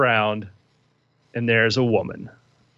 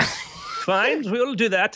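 high-pitched character voice; lilting game-master character voice; Adolfina, conceding; 8 semitones higher, much slower than usual. Acted. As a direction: agree grudgingly in a high, put-on voice.

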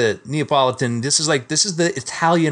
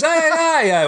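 high "Ay, ay, ay"; panicked Italian; 14 semitones higher, 3 dB louder than usual. Retold or imitated. Scared Italian cop grabbing the lapel, high "Ay, ay, ay". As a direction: gasp it like a terrified cop. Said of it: "He goes".

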